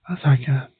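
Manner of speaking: normal talking voice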